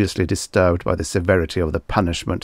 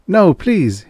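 high, louder, pained pleading; pained plea; 10 semitones higher, 5 dB louder than usual. A narrator's reading. Man pleads with the frightened girl, pained. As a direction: plead painfully, voice raised in pitch. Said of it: "he said painfully".

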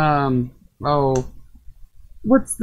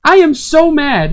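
slow, loud pretend fury; mock anger; 7 semitones higher, 7 dB louder than usual. Gamer pretending to rage at the boss, mock anger. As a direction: fake being furious, slowly. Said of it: "I'm just kidding, I'm not actually mad".

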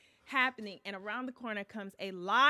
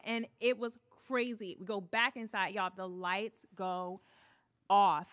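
ordinary talk

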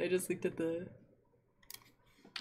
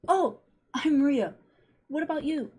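put-on higher, slow, shy little boy; bright boy voice; 7 semitones higher, 3 dB louder, slower than usual. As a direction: say it like a child introducing himself.

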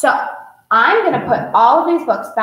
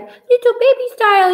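put-on much higher, babyish voice; little baby style; 12 semitones higher, 4 dB louder than usual. Shown as a demonstration. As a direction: sing it like a tiny baby. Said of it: "little baby style might be like".

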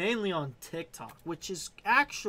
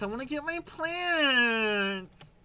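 slow, high put-on voice; silly voice; 7 semitones higher, much slower than usual. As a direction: drag it out in a goofy voice.

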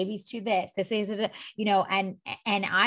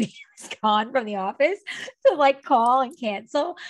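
giddy laughing; 5 semitones higher, 5 dB louder than usual. Real, not acted.

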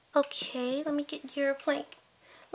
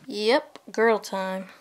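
ordinary talk, nothing marked

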